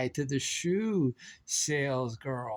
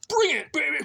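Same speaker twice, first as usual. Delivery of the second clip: high, hyped-up call; playful cheer; 9 semitones higher, 4 dB louder than usual. Pretend. Goofing about bringing the leaf blower, playful high cheer. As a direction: burst out high and punchy.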